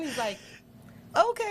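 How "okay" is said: high, chirpy okay; the boy approving the shot, pitched way up; satisfied little boy. Retold or imitated. Son checking the photo.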